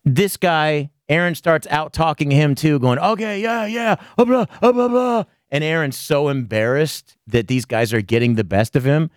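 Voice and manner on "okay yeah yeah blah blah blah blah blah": higher, dismissive blah-blah; mock rambling. Mocking Aaron's babble to the girl on stream, higher and dismissive. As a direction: toss off empty chatter in a mocking voice.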